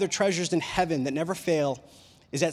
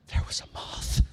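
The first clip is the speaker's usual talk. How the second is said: whispered, small and scared; timid whisper; 4 dB quieter than usual. Retold or imitated. Wife answering her husband from the ladder, scared whisper. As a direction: breathe it out like a frightened confession. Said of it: "She goes".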